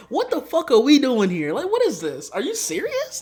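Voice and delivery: loud, high-pitched comic outrage; exasperated disbelief. Acted. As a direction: demand answers, pitch leaping up in disbelief. Exasperated rant about film's plot.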